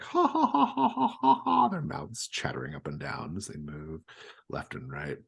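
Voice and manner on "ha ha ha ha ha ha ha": very high, mechanical ha ha ha; cartoon laugh. Animatronic Barnyard Gang laughing at each other, very high cartoon laugh. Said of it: "they laugh, like".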